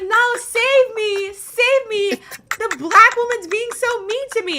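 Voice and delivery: high, loud whiny plea in a put-on voice; hammed-up damsel begging to be saved; mocking whiny victim. Mocking the troll who whines to the panel for rescue. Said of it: "She's like".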